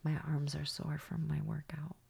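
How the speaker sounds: soft unvoiced whisper. Real, not acted. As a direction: whisper it as a private aside. Whispered aside.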